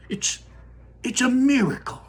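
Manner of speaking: high astonished cry; amazed. Acted. Grandpa Joe marvels aloud, amazed. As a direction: burst out in wonder.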